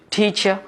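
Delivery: টিচার with the final r silent; British pronunciation. Shown as a demonstration. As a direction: say টিচার as "tee-cha". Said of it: "তাহলে British".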